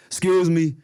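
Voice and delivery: a mild, polite "excuse me" from a big guy cutting the lunch line; big guy's voice. Retold or imitated. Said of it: "I like his voice".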